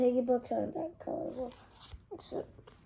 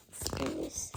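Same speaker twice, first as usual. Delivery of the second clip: whispered word; 8 dB quieter than usual. Acted.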